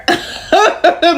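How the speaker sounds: bursting into a high laugh; real amused laugh. Real, not acted. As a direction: laugh high at your own joke. Laughs at rich-card joke.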